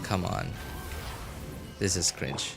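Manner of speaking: normal talking voice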